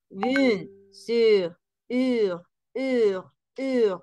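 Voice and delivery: "Bien sûr" broken down to a drawn-out "ur"; isolated ur sound. Shown as a demonstration. Teacher drilling the ending for students, slow pronunciation drill. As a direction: repeat "ur" separately, rounded French u. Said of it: "¿Escuchan?".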